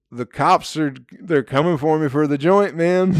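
acting out a paranoid stoner's panic; retold panic. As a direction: blurt it like you're about to get busted. Retells his panicked first thought.

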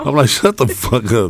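laughing disbelief. Retold or imitated. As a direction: keep laughing as you repeat it. Repeats retort, still laughing.

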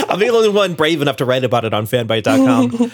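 grin through words; laughing. Real, not acted. Laughing joke reply.

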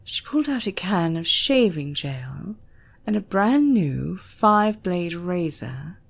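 ordinary delivery

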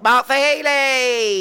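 stretched-out showbiz shout of the name; hyped name call. Acted. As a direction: announce the guest like a superstar. Host welcomes guest on board.

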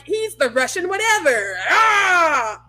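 shouted crowd hype; wrestling announcer shout. Retold or imitated. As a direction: yell like a wrestling fan. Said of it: "it's like".

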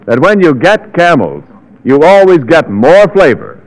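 ordinary talk

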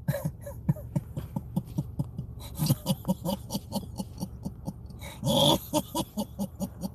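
long, soft wheezing laugh. Real, not acted. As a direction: laugh helplessly at your own joke. Laughs at own joke.

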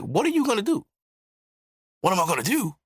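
mock face-off in a high voice; retold confrontational challenge. Retold face-off as father approaches.

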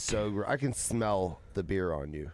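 low, soft, slow mock disgust; retold disgusted accusation. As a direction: accuse under your breath, imitating a partner. Retold partner accusing him of drinking.

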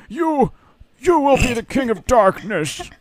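put-on hammy dying old man, high and slow; overacted death scene. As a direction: gasp out grand last words like a dying mentor. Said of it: "he has this overly dramatic death scene".

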